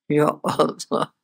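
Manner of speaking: drawn-out, low, soft lament; a slow, sorrowful "Ya Allah"; dismayed sigh. Real, not acted. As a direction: groan it out slowly in dismay. Preacher sighs in dismay.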